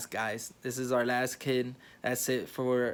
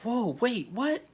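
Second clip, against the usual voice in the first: very high, rising disbelief; shocked whoa; 10 semitones higher, slower than usual. Retold or imitated. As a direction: squeak it out in shock. Shocked by push order.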